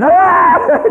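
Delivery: high, loud startled yell; mock scream of a frightened boy; retold scream. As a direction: scream like a kid jumping back from a snake.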